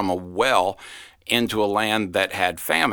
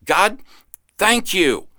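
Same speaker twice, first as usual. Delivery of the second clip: high, loud joyful prayer; 5 semitones higher than usual. Shown as a demonstration. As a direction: call it out to God with joy. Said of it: "Just go ahead and say out loud".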